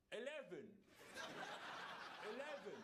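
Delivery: "Eleven" in a put-on English accent, laughing; a posh-leaning English attempt at "Eleven"; laughing fake English accent; mock English accent. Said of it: "Try an English accent".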